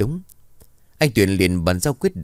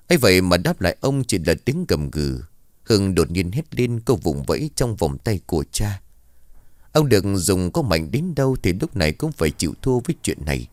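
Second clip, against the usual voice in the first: usual speaking voice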